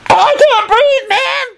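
very high, drawn-out screech; panicked scream. A narrator's reading. Kenny smothered by flour, screaming in panic. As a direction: scream slowly, gasping for help. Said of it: "he screamed".

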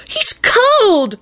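very high, squealed wail; acted high-pitched distress. A narrator's reading. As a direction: wail it in pitying alarm. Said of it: "Rosie wailed".

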